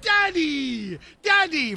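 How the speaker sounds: high mock yell, held back in volume; mock child scream. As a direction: imitate a kid calling for dad. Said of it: "staring at me and screaming".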